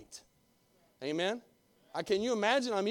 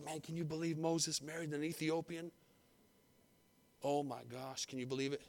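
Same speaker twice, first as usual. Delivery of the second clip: gossiping whisper; 4 semitones lower, 9 dB quieter than usual. Acted. Gossiping Miriam and Aaron voiced.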